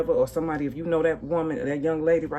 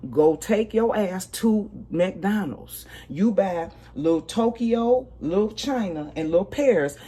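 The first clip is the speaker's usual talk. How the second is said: slow, higher, spelling out each step; slow instructions; 4 semitones higher, slower than usual. Shown as a demonstration. Schooling the young mother on what to do next time, slow and higher. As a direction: lay out the steps slowly like orders. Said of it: "This what your ass should do".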